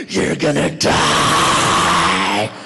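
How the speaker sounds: very low, demonic growl, drawn out; slow, deep horror-villain threat; menacing prank caller. Retold or imitated. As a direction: stretch the last word into a monstrous roar. Acts out his threatening prank call.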